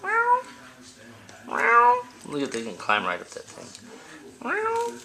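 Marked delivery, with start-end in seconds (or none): 0.0-2.0 much higher mock kitten meow; squeaky kitten imitation. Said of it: "imitating kitten". 4.4-4.9 much higher mock kitten meow; squeaky kitten imitation. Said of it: "imitating kitten".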